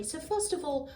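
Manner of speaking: normal talking voice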